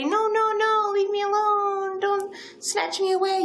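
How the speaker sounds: high mocking whine of protest; mock pleading.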